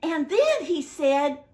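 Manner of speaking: plain reading voice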